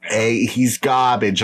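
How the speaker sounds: slow, hammed-up Boston accent. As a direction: put on a drawn-out Boston accent. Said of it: "That's my Boston accent".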